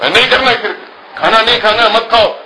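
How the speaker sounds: lightly voiced cross parent; mock snap. Parent snaps at child refusing dinner, high mock anger.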